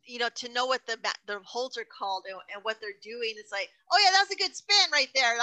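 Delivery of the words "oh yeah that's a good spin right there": higher and louder, a cheesy play-by-play voice; mock commentator. Wrestler mocking clueless commentator, hammed-up commentator voice. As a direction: ham up a clueless announcer.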